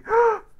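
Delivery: a sudden high loud gasp; sharp high gasp. Retold or imitated. The family hearing not guilty, a loud gasp. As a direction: gasp in shock.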